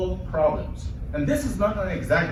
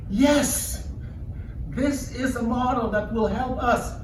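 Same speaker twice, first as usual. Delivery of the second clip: excited realization; 7 semitones higher, slower than usual. Retold or imitated. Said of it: "I felt".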